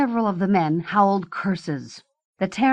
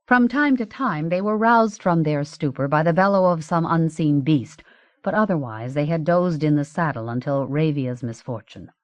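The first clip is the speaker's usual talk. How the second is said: ordinary delivery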